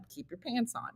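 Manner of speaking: very soft note to self; hushed retold reminder. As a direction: say it under the voice, as if to oneself. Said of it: "I have to say to myself".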